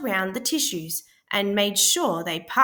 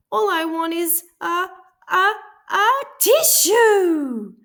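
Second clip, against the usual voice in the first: building to a sneeze; 10 semitones higher, 8 dB louder than usual. A narrator's reading. Sick wolf tries to ask for a tissue before sneezing. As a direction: stretch the a's as the sneeze builds. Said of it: "sniffled Big Bad".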